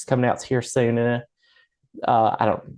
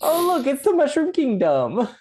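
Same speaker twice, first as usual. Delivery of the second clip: much higher, cartoonish Italian accent; squeaky Italian plumber accent, hammed up; Italian Mario voice; 12 semitones higher than usual. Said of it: "or whatever voice he'd do".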